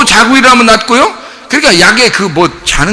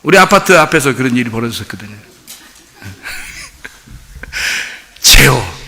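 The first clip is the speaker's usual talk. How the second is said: normal talking voice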